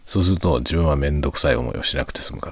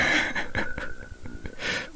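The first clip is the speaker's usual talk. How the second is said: tearful giggle; 4 semitones lower, 8 dB quieter than usual. Real, not acted. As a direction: laugh until almost crying. Drunk, laughing at himself.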